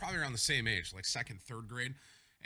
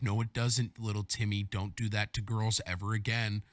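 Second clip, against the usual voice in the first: low, loud mock telling-off; mock scolding; 6 semitones lower, 7 dB louder than usual. Girl on the bus scolding little Timmy, low stern retelling. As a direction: imitate a stern older kid, low and forceful.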